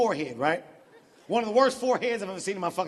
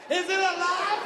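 higher, more alarmed "Is it alive?"; 6 semitones higher than usual. Shown as a demonstration. Comic about LeBron's hairline, rising alarm. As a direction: ask it again, more alarmed.

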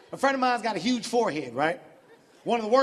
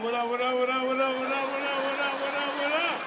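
smug repeated what up, slowing on the end; cocky greeting. Retold or imitated. [name] walking on stage tall, a light cocky greeting. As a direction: strut out greeting everyone. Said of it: "I just walk out".

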